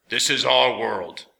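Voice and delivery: slow grinding robot voice; slow, strange machine speech; metallic voice. A narrator's reading. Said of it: "in the same strange metallic voice".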